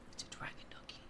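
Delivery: very soft, breath-only whisper; ASMR whisper. Real, not acted. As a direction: whisper it gently into the mic. Repeats it softly in ASMR stream.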